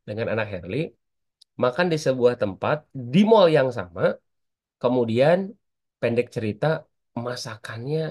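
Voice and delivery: ordinary talk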